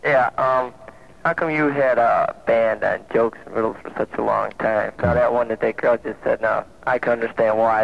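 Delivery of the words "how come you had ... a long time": ordinary talk, nothing marked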